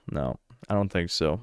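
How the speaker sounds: normal talking voice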